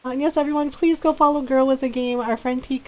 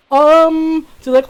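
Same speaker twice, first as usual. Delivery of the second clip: ordinary talk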